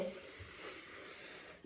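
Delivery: long unvoiced sss hiss; S sound for kids; snake hiss S. Shown as a demonstration. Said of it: "Sissy the sassy snake says".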